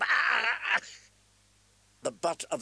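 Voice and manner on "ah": sharp high yelp of pain; pained cry. A narrator's reading. Smith cries out as he is struck, sharp yelp. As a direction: cry out as the spear hits.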